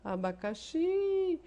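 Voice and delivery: abacaxi with a long, high-pitched last XI; stress on final XI. Shown as a demonstration. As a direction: slowly sing out the final XI of abacaxi. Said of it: "Esse X está na última sílaba".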